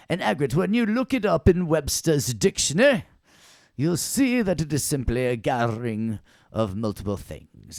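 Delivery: exaggerated British accent with loud, swooping emphasis. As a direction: lecture like a hammed-up British professor. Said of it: "talking British when I was talking about Webster".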